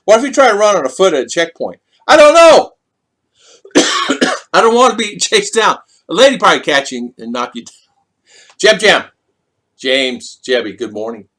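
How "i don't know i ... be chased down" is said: high, loud exclaimed shrug; mock panic. Vlogger imagining running at a checkpoint, loud high mock alarm. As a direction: throw up your hands, loud and high.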